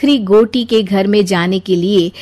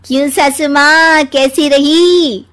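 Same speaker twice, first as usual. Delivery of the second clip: gloating taunt; 4 semitones higher than usual. Acted. Bahu gloats after winning.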